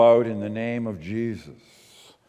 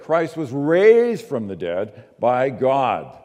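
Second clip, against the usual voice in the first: heavy stress on "raised", louder and drawn out; emphatic; 4 dB louder, slower than usual. Real, not acted. Preacher telling listeners what makes the cross special, emphatic on "raised". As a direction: punch "raised" hard, then land on "by God". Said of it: "That's the emphasis".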